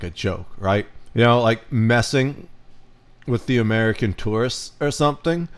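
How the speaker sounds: ordinary talk, nothing marked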